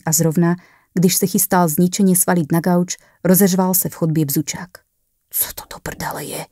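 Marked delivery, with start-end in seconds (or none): 5.3-6.5 frightened, hushed curse; whispered fright at the buzzer; startled whisper. A narrator's reading. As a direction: gasp it out in a whisper, spooked. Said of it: "lekl se".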